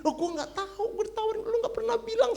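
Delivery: very high, quick, defensive voice; high innocent reply. Retold or imitated. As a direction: squeak out a flustered excuse. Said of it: "Saya bilang".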